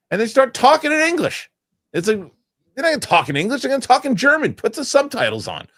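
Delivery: high-pitched, incredulous rant; worked up, pitch soaring in disbelief; exasperated. Real, not acted. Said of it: "that kind of stuff drives me nuts".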